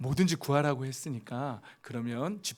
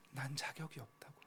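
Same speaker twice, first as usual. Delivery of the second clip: a soft, much quieter plea of unworthiness; 아버지 앞에서 부끄러워 작게 중얼거리듯; the prodigal's plea; 2 semitones higher, 12 dB quieter than usual. Retold or imitated. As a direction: murmur it like a son ashamed before his father. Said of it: "탕자가 아버지 앞에".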